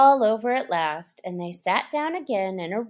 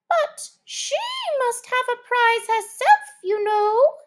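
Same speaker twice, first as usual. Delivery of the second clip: much higher squeaky voice; mouse voice; 5 dB louder than usual. A narrator's reading.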